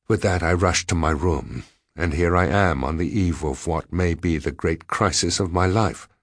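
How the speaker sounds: ordinary delivery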